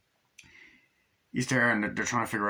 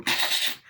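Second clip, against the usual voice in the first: voiceless whooshing blast; imitated flamethrower. Retelling the flamethrower firing, imitated whoosh. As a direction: blow out a burst of fire noise.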